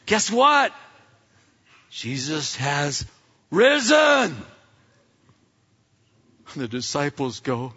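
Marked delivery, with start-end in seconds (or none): 0.1-4.4 slow build to a high, loud finish; excited announcement. Acted. Women tell the disciples the news, excited. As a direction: hold back, then burst out with the news.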